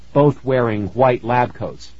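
usual speaking voice

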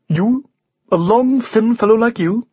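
high-pitched, scornful unicorn; disdainful. A narrator's reading. Unicorn sizes up the thin knight, haughtily disdainful. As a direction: look down on the puny rider. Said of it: "said the unicorn disdainfully".

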